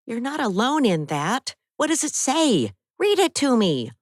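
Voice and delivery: a worried friend scolding a little; Abby's voice. Acted. Abby voiced in story.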